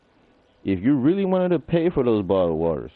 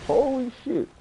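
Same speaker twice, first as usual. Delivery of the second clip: much higher startled yelp; jolted by the enemy; real surprise. Real, not acted. Gamer startled by an enemy in the game, yelping. Sudden game moment, big jump.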